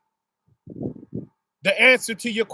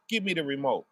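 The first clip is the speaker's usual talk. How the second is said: even lower and softer deadpan request; mother asking for the remote; 7 semitones lower, 7 dB quieter, faster than usual. Retold or imitated. A lower, quieter mom deflating the kid's hopes. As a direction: drop the voice right down, flat and plain. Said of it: "she says".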